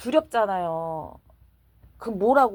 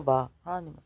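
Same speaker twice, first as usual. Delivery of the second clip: normal talking voice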